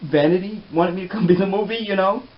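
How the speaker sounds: rising, fast, mock-defensive; acted self-justification. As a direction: answer the doubters loudly, pitch climbing at the end. Defending himself to the extras.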